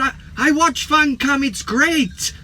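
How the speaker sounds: high-pitched imitation Welsh lilt; Welsh accent. Welsh fan telling the vlogger he watches, imitated Welsh accent. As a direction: mimic a keen fan in a sing-song Welsh accent. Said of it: "he was Welsh".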